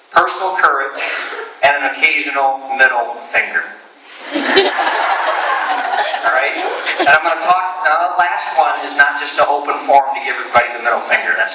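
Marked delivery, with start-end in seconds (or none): 4.2-6.2 short laugh; real laugh at own joke; high burst of laughter. Real, not acted. Laughs at middle finger joke.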